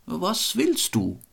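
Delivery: high, slow, teasing question; mockingly sweet apparition. A narrator's reading. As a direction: draw it out high with lofty mockery. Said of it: "lächelte ganz spöttisch und sprach".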